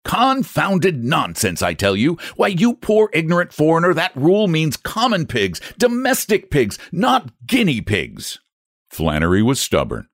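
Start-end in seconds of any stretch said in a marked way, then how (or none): 0.0-8.4 angry customer. Acted. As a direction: talk down to him in fury. Morehouse berates agent.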